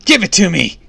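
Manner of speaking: high, very loud villain's command; furious scream. A narrator's reading. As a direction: scream orders at the girl. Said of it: "she screamed".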